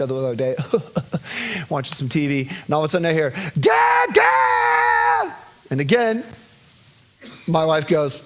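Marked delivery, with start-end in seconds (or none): 3.6-5.3 put-on high loud child's yell; calling dad. Daughter calling father from bed, high loud yell. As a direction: shout for daddy from upstairs.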